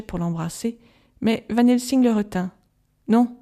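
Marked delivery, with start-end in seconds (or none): none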